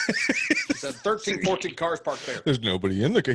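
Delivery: high Southern voice with a laugh; Southern accent. Acted. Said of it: "the accent that you did".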